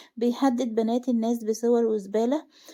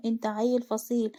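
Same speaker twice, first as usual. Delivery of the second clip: ordinary delivery, nothing marked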